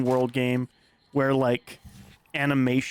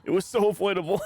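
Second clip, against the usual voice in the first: laughing; 6 semitones higher than usual. Real, not acted. Laughing at friend's mistake.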